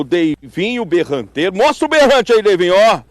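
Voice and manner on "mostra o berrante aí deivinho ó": calling out. Real, not acted. Host asks Deivinho to show his horn, calling out. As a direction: call out to someone across the fairground. Host calls out to berrante player.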